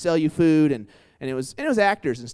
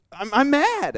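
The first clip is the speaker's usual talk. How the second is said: high, indignant mock scold, rising louder to the end; imitating mad Nikki; 12 semitones higher, 4 dB louder, slower than usual. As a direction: play the offended coworker, pitch climbing. Nikki insisting she is mad.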